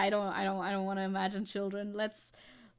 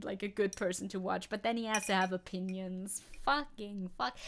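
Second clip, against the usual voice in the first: ordinary talk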